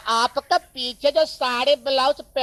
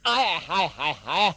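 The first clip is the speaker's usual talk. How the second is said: mock swoon; 8 semitones lower, 4 dB quieter than usual. Dhobi reacts to saree removed.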